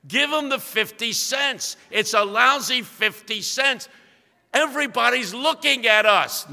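a mortified wife begging her husband to pay; retold yelling wife. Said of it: "she's yelling at him".

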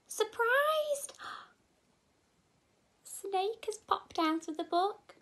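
gasping, high surprised; mock startled gasp. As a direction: gasp with wide-eyed shock.

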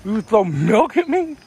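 can't believe she threw it; indignant disbelief. Real, not acted. Milk thrown at him.

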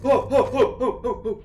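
rapid high yelps tailing off; startled gasping yelps. Real, not acted. Player just scared by the game, startled yelps. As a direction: burst of short, loud frightened hoots.

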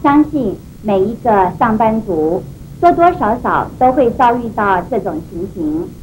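ordinary delivery

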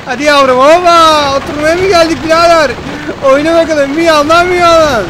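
loud, high playful hype; excited cheering. Real, not acted. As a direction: egg it on like a fan. Cheering the ship on. (coşkulu tezahürat)

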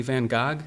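Gogh said as "Gog", ending in a hard g; deliberate mispronunciation. Acted. Father teasing his son about the museum, Gogh deliberately mispronounced as "Gog". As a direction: say Gogh to rhyme with dog, on purpose. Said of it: "he would pronounce it Gog on purpose".

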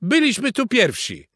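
high-pitched rooster protest; indignant call. A narrator's reading. Rooster claiming the beach from beavers, calling out. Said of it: "zawołał Kogut".

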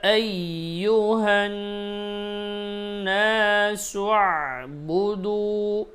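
slow, high, drawn-out chant; Quranic recitation. Acted. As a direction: recite it like tilawat, stretching أيها. (ধীর সুরেলা তিলাওয়াত)

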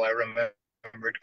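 ordinary delivery, nothing marked

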